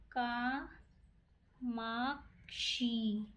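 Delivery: each syllable stretched long and soft; slow, quiet dictation. Shown as a demonstration. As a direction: draw the word out for students. Teacher dictates word while writing.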